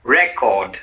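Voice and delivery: "record" as REK-ord; strong REC, falling through record; stress on first syllable. Shown as a demonstration. Teacher models the noun for repetition, record stressed on REC. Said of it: "said in two different ways".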